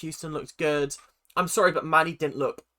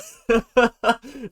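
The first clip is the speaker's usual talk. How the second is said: short burst of gleeful laughter; gleeful cackle; 6 semitones higher, 5 dB louder than usual. Real, not acted. Viewer laughing at her own toxic joke, a gleeful cackle. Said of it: "I'm just being toxic now".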